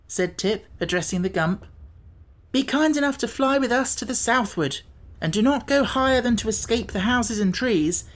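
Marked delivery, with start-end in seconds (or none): 2.5-8.0 put-on high-pitched boy making a request; boyish, higher voice; Tip, polite boy. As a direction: ask nicely in a young lad's voice.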